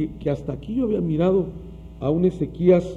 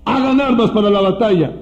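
"Hagan" boomed louder as a king's order; loud command; 8 dB louder than usual. Retold or imitated. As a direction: shout "Hagan armas" like a royal command. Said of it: "empieza a forjar armas".

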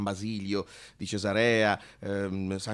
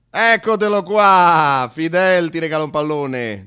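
proud announcement; 6 semitones higher, 11 dB louder than usual. Retold or imitated. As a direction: hand over the ball like a grand gift. Official hands over ball proudly. (funzionario che annuncia a Pedro, forte e trionfale)

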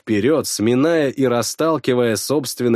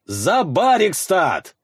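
slow, higher rallying battle cry; war cry; 6 semitones higher, slower than usual. Acted. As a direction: call the warriors to battle. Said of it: "Зов Ярла".

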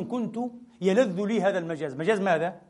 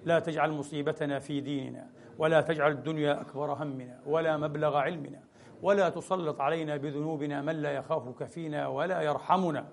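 steady, lower supplication chant; closing prayer; 4 semitones lower, 4 dB quieter than usual. Acted. Preacher leading the congregation in closing supplication, low and steady. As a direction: pray humbly and evenly for all.

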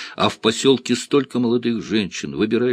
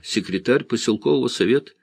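ordinary delivery

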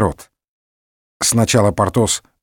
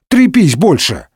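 higher, louder scornful jeer; contemptuous; 5 semitones higher, 4 dB louder than usual. A narrator's reading. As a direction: throw it back with contempt. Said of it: "презрительно ответил номер второй". (презрительно, повыше)

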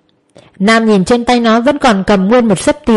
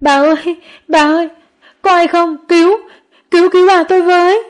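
very high, frantic pleas for help; desperate crying out; 9 semitones higher than usual. A narrator's reading. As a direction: call for help in despair. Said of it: "Nam càng gào thét lên". (gào thét cầu cứu tuyệt vọng)

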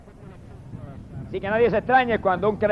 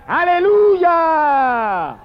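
high, very long drawn-out cry; shouted hallelujah; 7 semitones higher, 4 dB louder, much slower than usual. Retold or imitated. Preacher voices heaven's multitude praising God, long exultant shout. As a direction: stretch it out like a multitude crying praise. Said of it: "y gritaban".

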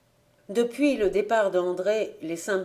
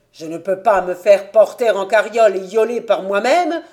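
loud, mounting demand; choking anger; 10 dB louder than usual. A narrator's reading. Invalid son demanding that father admit it, angry. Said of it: "suffoquant de colère".

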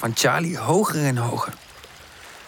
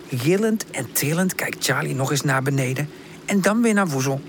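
plain reading voice

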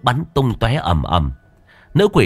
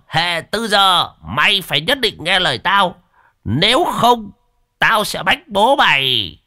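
put-on old master shooing; 8 semitones higher than usual. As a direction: brush the boy off with mock alarm. Old master teases while fleeing.

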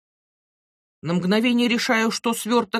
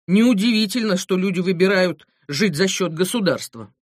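usual speaking voice

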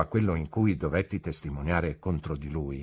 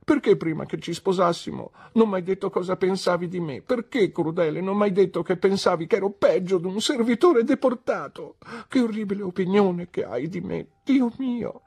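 put-on high, tearful childlike wife voice; a wounded girl scolding her cruel husband; hurt young wife; 10 semitones higher than usual. A narrator's reading. Dora's wounded complaint to husband. (la moglie ferita rimprovera il marito, voce alta e lamentosa)

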